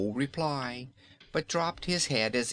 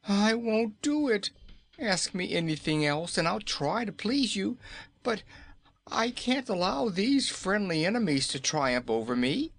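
high, petulant king's voice; a sulky ruler refusing; stubborn Nome King; 10 semitones higher than usual. Acted. Nome King refusing Dorothy, petulant and high.

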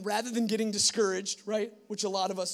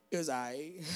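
playing it cool; 4 semitones lower than usual. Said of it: "I'm trying to play it hard".

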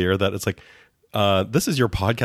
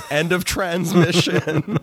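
robot sign-off; 11 semitones higher, slower than usual. Pretend. Mock robot sign-off of AI script.

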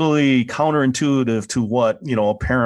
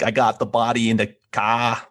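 put-on Boston accent, dropped R; hammed-up Boston townie accent. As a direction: imitate a thick Boston accent. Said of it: "a Boston accent".

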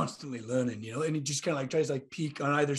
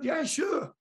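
eager squeak; 12 semitones higher than usual. Acted. Dwarf eagerly accepts smithing lessons.

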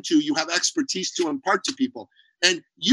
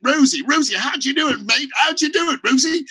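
put-on Australian accent; 8 semitones higher than usual. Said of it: "with the Australian accent".

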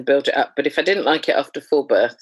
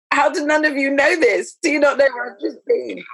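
amazed disbelief; 11 semitones higher than usual. Retold or imitated. Marvelling at the world after birth.

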